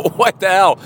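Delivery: high, loud bewildered exclamation; half-awake shocked outburst; startled confusion. Retold or imitated. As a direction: jolt upright and demand it. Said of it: "I sat up and I go".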